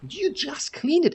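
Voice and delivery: high-pitched retold outrage; indignant retort. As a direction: throw the refusal back, voice shooting up. Retold angry refusal to roommate.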